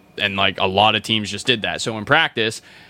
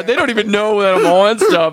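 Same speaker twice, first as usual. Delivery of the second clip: ordinary talk, nothing marked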